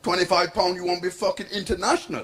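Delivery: put-on scornful Jamaican-accented comeback. [name] scoffs at her price, Jamaican accent. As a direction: haggle back in a broad Jamaican accent. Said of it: "This is my Jamaican accent now".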